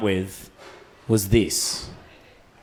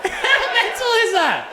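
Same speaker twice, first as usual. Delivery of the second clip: high laughing disbelief; breathless laugh; slower than usual. Real, not acted. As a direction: laugh through the words.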